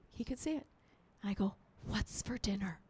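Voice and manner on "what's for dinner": very soft, low murmur; hushed casual text; innocent texting. Retold or imitated. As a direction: read it out quietly as if typing. Mimes typing an innocent text.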